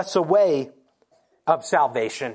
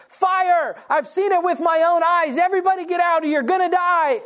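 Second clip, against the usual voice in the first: yelling fire; 9 semitones higher than usual. Retold or imitated. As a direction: warn the whole theater to get out. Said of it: "and he yells".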